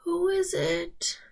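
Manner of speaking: a grandmother calling from bed; old grandmother. Acted. Grandmother answers door.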